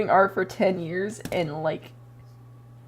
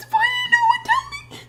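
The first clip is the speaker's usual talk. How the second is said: very high, fast crying whine; mock wail; 5 dB louder, faster than usual. Asking mom why nobody told her, mock crying. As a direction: wail it like a betrayed kid.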